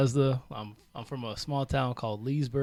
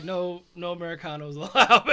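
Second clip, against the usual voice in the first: laughing mock quote; 3 semitones higher, 9 dB louder than usual. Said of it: "they're like".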